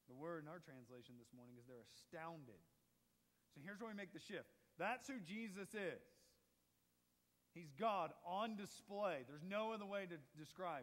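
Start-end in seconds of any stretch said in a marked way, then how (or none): 4.8-10.8 full-force preaching. Acted. Preaching the conclusion.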